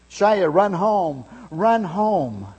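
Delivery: loud, slow urging cry; boys screaming. Retold or imitated. Boys from both teams screaming Shia home, loud. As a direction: call him home, drawn out.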